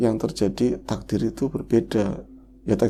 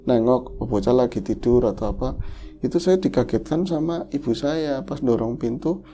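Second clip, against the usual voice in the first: ordinary talk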